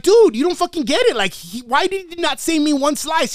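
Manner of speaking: high, exasperated retold plea; indignant protest. Kid protesting to his mom over pizza, exasperated. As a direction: replay a kid arguing his case.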